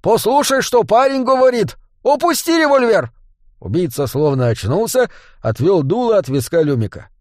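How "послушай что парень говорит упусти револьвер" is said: commanding bark, pitched high; insistent high-pitched yell; barked order. A narrator's reading. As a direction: bark orders at an armed companion. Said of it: "Дворф и рявкнул".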